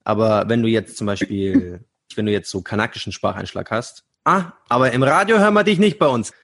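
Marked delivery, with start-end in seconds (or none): 4.2-6.3 higher, louder snooty put-on voice; mock radio gatekeeper. Mocking Bavarian radio rejecting accents, snooty and louder. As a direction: sneer it like a smug radio boss.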